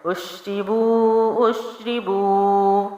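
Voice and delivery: slow, melodic tajweed recitation; Quran recitation. Shown as a demonstration. Teacher modelling the word in slow recitation. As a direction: chant it slowly, one alif long. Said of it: "حروف مدہ جب وقف میں آ جائے تو اس کو ایک الف ہی لمبا".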